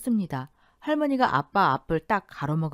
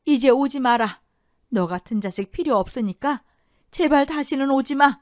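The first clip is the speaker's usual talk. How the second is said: louder, shouted after him; calling out loudly; 8 semitones higher, 6 dB louder than usual. A narrator's reading. Grandmother shouting after her departing son. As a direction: shout at his back as he leaves. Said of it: "크게 소리치셨습니다".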